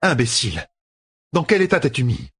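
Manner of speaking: high, exasperated woman's voice; scolding sister. A narrator's reading. Sister scolds wounded Logain.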